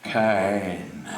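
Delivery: put-on very slow, low Upper East Side accent, "Kern" as "Kine"; Harpo Marx. Son demonstrating his dad's way with names, slow deep Upper East Side accent. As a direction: stretch out "Jerome Kern", Kern turned to "Kehyn", Upper East Side. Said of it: "Jerome Kern the way Harpo would say it".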